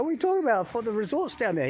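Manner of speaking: high-pitched mock disbelief; retold incredulous protest. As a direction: push back, pitch jumping up in disbelief. Retold protest at being corrected.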